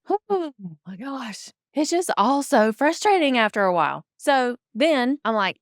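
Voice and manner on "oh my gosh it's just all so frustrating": exasperated. Real, not acted. Exasperated at assistants.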